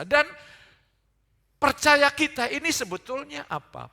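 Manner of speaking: loud, high challenge to the congregation; rousing preacher's question. Real, not acted. (bertanya lantang seperti pengkhotbah)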